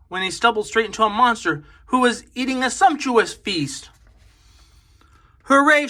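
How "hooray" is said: high, loud cheer; shout. Acted. Boy finds the monster and cheers loudly. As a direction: shout it out in joy. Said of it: "shouted George".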